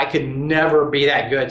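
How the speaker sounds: ordinary delivery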